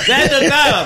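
mock jealous lover. Imita a la gata reclamando.

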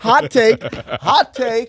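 teasing a friend's opinion, loud; loud hot take. Real, not acted. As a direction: announce it loudly. Mocking reply to opinion.

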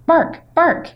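imitated dog barks, high and sharp; dog barking. A narrator's reading. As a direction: bark it out like the neighbor's dog. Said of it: "That's their dog".

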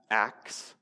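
normal talking voice

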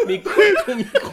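high and loud through laughter; laughing. Real, not acted. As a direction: beg between bursts of laughing. Laughing at inaudible clip.

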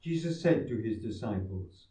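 proclaiming scripture aloud; formal reading. Acted. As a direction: read like a solemn lector. Priest proclaims the gospel.

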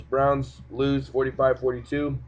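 normal talking voice

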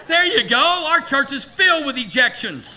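high and loud, laughing as he jokes; laughing excitement. Real, not acted. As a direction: burst out laughing at his own joke. Laughing joke to congregation.

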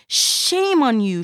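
yelled scolding. Acted. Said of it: "she yelled".

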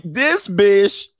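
a mother who can't believe what she's hearing; mom's outraged reaction. Retold or imitated. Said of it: "mom is like".

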